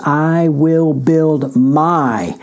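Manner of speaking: "I will build my" drawn out, I stressed; deliberate, weighty preaching; slow emphatic quote. Acted. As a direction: stretch each word for weight. Preacher repeats the quote slowly.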